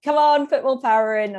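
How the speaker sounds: urging partner. Retold or imitated. Cyclist imitating Steve on the tandem, urging loudly. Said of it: "he's always like".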